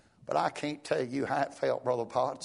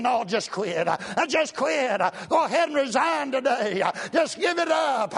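devil's voice; 8 semitones higher, 5 dB louder than usual. Retold or imitated. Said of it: "the devil's saying".

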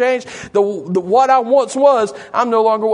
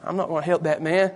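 low, softened aside; dismissive passer-by; 4 semitones lower, 6 dB quieter than usual. Acted. Passer-by refusing to help the beggar, low and dismissive. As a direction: mutter it privately, refusing to get involved. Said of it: "You know what some people will do?".

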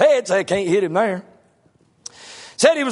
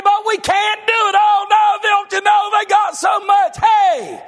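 higher, louder mock panic; mock cry; 10 semitones higher, 4 dB louder than usual. Preacher mocks fearful Christians, loud panicky cry. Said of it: "You gonna say".

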